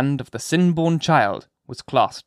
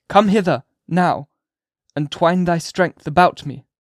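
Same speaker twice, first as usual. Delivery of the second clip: high, loud, slow fervent pleading; impassioned dying minister; 4 semitones higher, 4 dB louder than usual. A narrator's reading. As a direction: beg her with your last strength.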